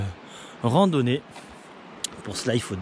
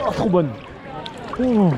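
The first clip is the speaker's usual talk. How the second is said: excited; 6 semitones higher than usual. Real, not acted. Reacting while swimming.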